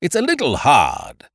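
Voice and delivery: high, whiny complaint; querulous. A narrator's reading. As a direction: complain peevishly. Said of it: "he said querulously".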